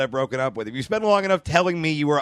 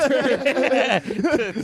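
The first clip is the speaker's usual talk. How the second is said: witch laughter; 7 semitones higher than usual. Pretend. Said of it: "simultaneous witch laughter".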